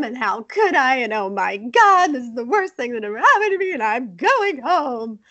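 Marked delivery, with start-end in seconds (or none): 1.1-5.2 mock victim wail. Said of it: "she's acting like she's such a victim".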